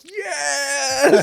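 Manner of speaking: very high, loud, drawn-out yes; mock eager yell. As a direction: squeal it like a family jumping at the offer. Family eagerly accepts money, acted.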